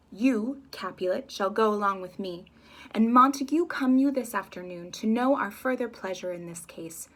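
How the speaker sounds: slow, loud royal decree; slow, loud stern ruler; commanding prince. Acted. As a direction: pronounce the sentence gravely.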